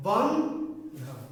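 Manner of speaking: "বন্ধন" very slowly as two syllables, বন্, then ধন; split into syllables. Shown as a demonstration. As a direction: break "Bandhan" after বন্ with a clear pause. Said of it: "কিন্তু অক্ষর দুইটি। কি কি?".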